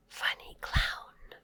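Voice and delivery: slow soft whispered repeat. Shown as a demonstration. Teacher whispering the phrase back for kids. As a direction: breathe it slowly and softly.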